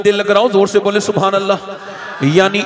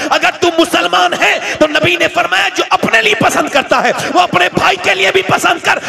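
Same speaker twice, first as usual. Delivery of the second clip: fiery preaching; 8 semitones higher than usual. Acted. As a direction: thunder at the crowd. (जोशीला ऊँचा was)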